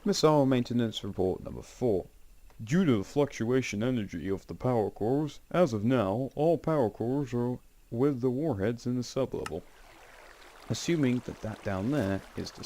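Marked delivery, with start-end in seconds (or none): 0.0-9.6 higher, formal announcement-like reading; report read-aloud. Acted. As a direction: read out an official maintenance report. Reads an in-game report aloud.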